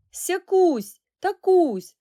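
high, loud calls to separate the twins; girl calls loudly to the twins; calling out. Acted. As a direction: shout their names to stop the fight. Alya calls the fighting twins.

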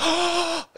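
high sharp mock gasp; high hammed-up gasp; shocked gasp. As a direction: gasp in fake offence. Mock shock at being called old.

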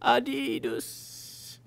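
put-on high, slow "Adidas" in a learner's accent; foreign learner's accent. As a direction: stretch it out like a new English learner. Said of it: "Cal said".

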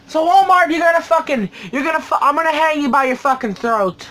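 loud, high-pitched angry threat; pissed-off threat. Real, not acted. Collector threatens the store over damaged packages, loud and pissed off. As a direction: raise your voice and threaten them. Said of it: "a lot pissed when I find".

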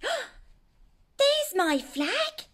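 slow, high wonder at finding it; delighted gasp. Acted. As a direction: gasp and marvel at the find. Dragon spots his flag.

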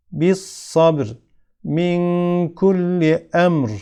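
"Bis sabr, Min kulli emr, Fis sudur" recited with a heavy, thick final r. Shown as a demonstration. Teacher shows ra after a sakin letter, "sabr, emr, sudur" with thick r. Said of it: "yine ra harfi kalın okunur".

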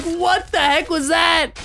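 high, drawn-out imitation of shocked players; hammed-up disbelief, pitched high; mock bewildered outcry. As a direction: exclaim it as the baffled victims. Voicing baffled players.

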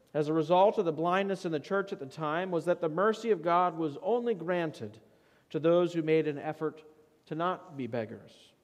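normal talking voice

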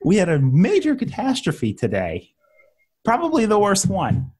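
overexcited anchor breaking the news; mock excited anchor. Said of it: "They're not gonna sound excited".

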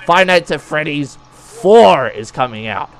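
Four said slowly and firmly; correcting, each word spelled out; stress on Four. Shown as a demonstration. Correcting his buddy, slow and firm. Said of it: "what did you just say".

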